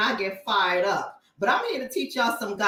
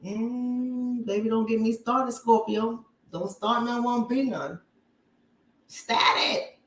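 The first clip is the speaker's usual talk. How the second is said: sassy warning; slower than usual. Real, not acted.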